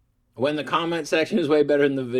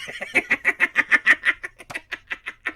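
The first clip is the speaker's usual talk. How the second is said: high squeaky laugh. Real, not acted. Creator laughing again at his own joke. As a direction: giggle jumping way up in pitch.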